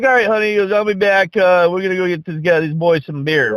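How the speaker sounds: lightly voiced heavy-set dad calling back to his wife; imitating the dad. Dad telling wife he's going.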